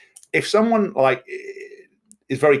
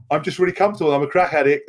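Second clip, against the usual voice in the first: mock crack addict; 4 semitones higher than usual. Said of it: "the crack addict go, going".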